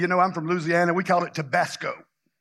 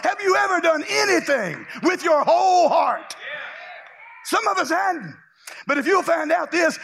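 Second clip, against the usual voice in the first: high, driving exhortation; full-force preaching; 7 semitones higher than usual. Real, not acted. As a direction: press the congregation hard, rising to a shout.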